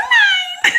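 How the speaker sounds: squeaky, very high comic catchphrase; sheepish little falsetto punchline; Dana Carvey. Acted. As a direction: suddenly pipe up high, then crack up laughing. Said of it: "Dana Carvey used to do this thing".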